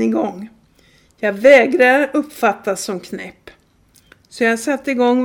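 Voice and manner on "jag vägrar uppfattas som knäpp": ordinary delivery, nothing marked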